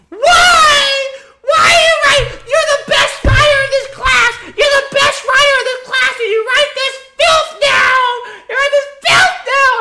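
teacher sobbing and screaming at the student over his dark skit; crying shout. Retold or imitated. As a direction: wail it like a distraught teacher crying mid-scolding. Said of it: "she starts crying".

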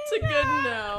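high, strained laughing cry; laughing hard. Real, not acted. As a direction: squeeze it out through laughter. Laughing hysterically.

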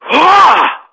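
sudden loud, high burst of breath; imitated ghostly "Ha!". Unseen voice right behind father and son, sudden ghostly "ha". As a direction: startle them with an eerie "ha". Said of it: "this voice goes".

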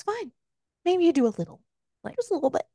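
a light touch of sass, tossed off playfully; sassy. Retold or imitated. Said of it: "Honestly, sass".

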